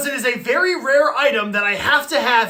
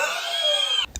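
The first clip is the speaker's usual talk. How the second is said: very high, softer hacking wheeze; wheezing cough; 6 dB quieter than usual. Acted. Sick boy pleading with nurse, wheezing. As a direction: cough yourself out of breath.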